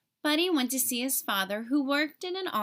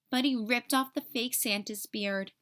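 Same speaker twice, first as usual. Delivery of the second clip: usual speaking voice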